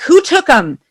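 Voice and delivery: retold alarm, pitched high; startled question. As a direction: ask it in sudden alarm, high up. Retold shock at missing cases.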